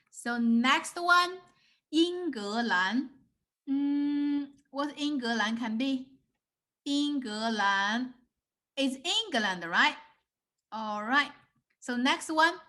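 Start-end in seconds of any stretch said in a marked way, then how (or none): 1.9-3.1 英格兰 drawn out slowly; slow teacher's model of 英格兰; slow syllables. Shown as a demonstration. Teacher poses word in guessing game. 6.8-8.1 英格兰 stretched out slowly; slow teacher's model of 英格兰; slow syllables. Shown as a demonstration. Repeats word for learners to guess.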